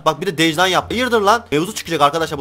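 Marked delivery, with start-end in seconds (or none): 0.9-1.4 high, indignant challenge; annoyed. Real, not acted. Player squaring up to a rival trader, indignant. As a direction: square up to them, pitched up. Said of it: "çok sinirlendim gerçekten".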